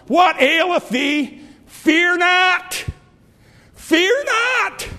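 very high, ringing and slow; high angel voice. Acted. Preacher voicing the angel comforting, very high. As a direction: float it high like a heavenly call.

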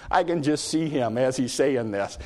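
ordinary delivery

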